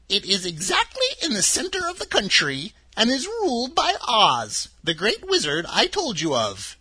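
put-on a sweet little old woman, high and gentle; old witch of the North. Witch of the North telling Dorothy her home, high and kindly.

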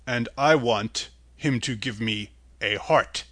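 slow, low, hollow-sounding tin man; Tin Woodman. A narrator's reading.

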